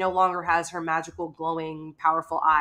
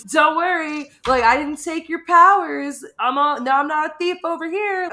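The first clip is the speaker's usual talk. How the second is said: joking; 9 semitones higher, 5 dB louder than usual. Retold or imitated. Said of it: "he's, like, cracking jokes".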